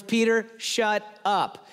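loud, very slow command, word by word; emphatic, drawn-out mock-divine rebuke; God silencing Peter. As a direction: cut someone off with heavenly authority. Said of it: "In other words".

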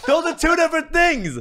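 very high, very loud protest; worked-up yelling over laughter; indignant shouting. Real, not acted. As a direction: shout it down with exasperated comic outrage. Argues back at producer over insult.

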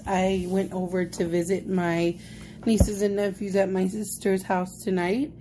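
normal talking voice

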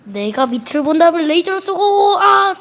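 loud startled yelp mid-sentence; startled shout. Real, not acted. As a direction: yell as the laser hits. Laser hits in game. (깜짝 놀란 외침)

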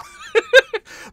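high, loud laugh; burst of laughter. Real, not acted. Amused at the video.

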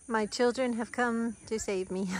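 normal talking voice

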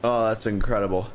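ordinary delivery

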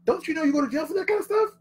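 high-voiced, baffled "don't you know?"; incredulous reaction. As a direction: squeal it up high in disbelief. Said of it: "I'm always like".

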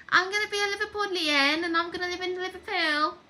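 a light put-on Liverpool Scouse voice; Scouse accent. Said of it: "I'm gonna have a Scouse accent".

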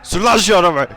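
loud, high furious shout; shouted at the stubborn driver, full force; angry yell. Acted. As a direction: scream the order at him. Yelling at driver to go.

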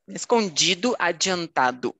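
"Escondido, adiantado" ending in "doo", DI as "jee"; final O as U. Shown as a demonstration. Teacher models again, "Escondido, adiantado" ending in U. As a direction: close the last vowel of "Escondido, adiantado" to U. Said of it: "o aí seria um U".

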